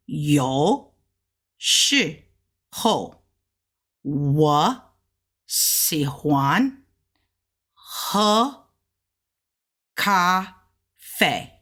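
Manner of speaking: each syllable said apart with its tone, mostly low; Mandarin sentence. Shown as a demonstration. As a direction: read the sentence syllable by syllable with tones. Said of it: "first tone all the way across".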